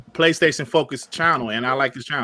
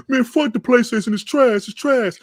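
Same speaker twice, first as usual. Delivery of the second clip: mock trash talk; 5 semitones higher than usual. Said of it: "you will not hear me and Sean is talking".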